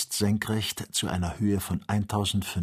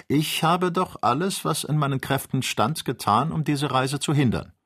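raised pitch, insistent objection; earnest protest; 7 semitones higher than usual. Acted. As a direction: plead your case, voice lifted. Friend protests against the journey.

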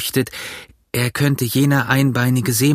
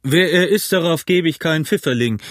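higher, gruffly indignant landlord's call; 5 semitones higher than usual. A narrator's reading. Innkeeper reacts to the runaway guest, calling out. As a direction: exclaim it across the room. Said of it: "rief Silver".

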